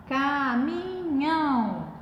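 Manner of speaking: "caminhão" drawn out in three long syllables; teacher stretching "caminhão" syllable after syllable. Shown as a demonstration. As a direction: say "caminhão" slowly, holding every syllable. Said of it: "aquela separação que nós aprendemos".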